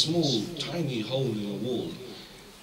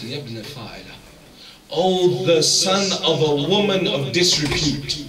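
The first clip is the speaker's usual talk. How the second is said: a sudden sharp insult, louder, rising at oh; angry outburst; 3 semitones higher, 7 dB louder than usual. Retold or imitated. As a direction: retell the companion snapping at his slave in anger. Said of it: "he becomes angry and he cries out".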